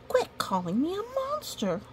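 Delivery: lightly voiced high, angry little monster; mock-huffy monster complaint. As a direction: squeal it like a cross little monster. Monster's angry line from book.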